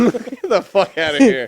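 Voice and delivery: high, loud laugh through the words; cracking up in disbelief; laughing it off at the misread note. Real, not acted.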